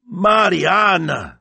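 high, loud, drawn-out name; long, soaring exclamation; excited cry. A narrator's reading. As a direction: cry out to your sister in amazement. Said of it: "she cried".

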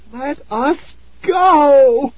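put-on high, slow, trembling girl's plea. A narrator's reading. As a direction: cry it out high and shaky with fear. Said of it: "Tara cried in a high, trembling voice".

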